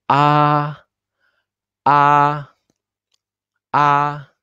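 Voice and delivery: "Ah" held high and level, a steady first-tone pitch; first tone demo. Shown as a demonstration. Teacher shows students tone one: high flat "Ah". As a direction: sing "Ah" on one high flat note, like Mandarin tone one. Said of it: "el tono uno es siempre muy, muy alta de tu voz".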